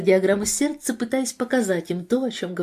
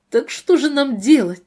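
higher, louder strained plea; choked, holding back tears; 6 semitones higher, 6 dB louder than usual. A narrator's reading. Mother asking the doctor for help, choked voice. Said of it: "спросила Бренда сдавленным голосом, сдерживая слёзы".

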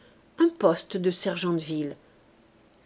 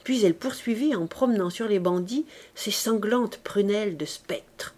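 usual speaking voice

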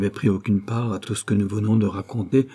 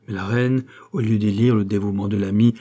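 ordinary delivery

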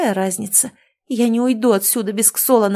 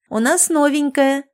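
higher, excited call to the crowd; shouting out; 7 semitones higher, 3 dB louder than usual. Acted. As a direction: call out the news across the beach. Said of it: "прокричала одна из прибывших".

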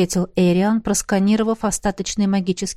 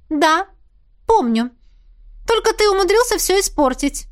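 petulant princess; 11 semitones higher than usual. A narrator's reading. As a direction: scold like a spoiled royal. Princess reproaching.

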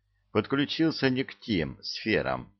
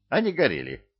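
ordinary delivery

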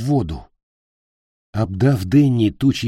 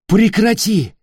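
high, loud command; alarmed shout; 12 semitones higher, 6 dB louder, slower than usual. A narrator's reading. Ник blocks Питер with a spear, shouting. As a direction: shout to break up the fight. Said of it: "закричал Ник".

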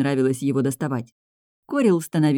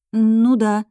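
strained, reluctant yes; forced admission; 6 semitones higher, 6 dB louder than usual. A narrator's reading. Girl cornered by cook's question, forcing it out. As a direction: squeeze it out reluctantly, higher and louder. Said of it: "выдавила я".

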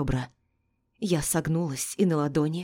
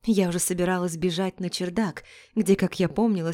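ordinary delivery

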